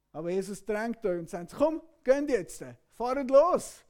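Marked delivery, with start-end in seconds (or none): 1.5-3.8 raised, pressing voice to go now; urgent call. Acted. Jesus urging hesitant disciples to leave, loud urgent call. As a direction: push them out the door loudly.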